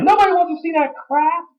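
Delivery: high-pitched mock Rock voice; The Rock impression. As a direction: scoff it up high in a cartoon voice. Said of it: "He's like".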